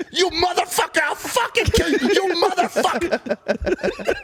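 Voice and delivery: high-pitched mock screaming fit; enraged threat. Imitating a raging friend turning on the driver, furious scream. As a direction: scream it as the friend who has lost it.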